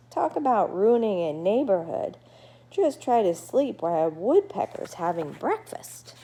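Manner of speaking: a friendly bat gabbing at his new neighbor; chatty bat Murray. Acted.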